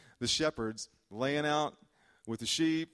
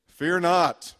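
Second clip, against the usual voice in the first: loud, booming mock angel greeting; hammed-up heavenly shout; 6 semitones higher, 13 dB louder than usual. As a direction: belt it out like an angel bursting in.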